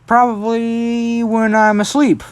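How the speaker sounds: slow, high, held-out answer; drawn-out quip. Retold or imitated. Deku answers brother about naps, drawn-out high quip. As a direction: stretch it out high as if pondering.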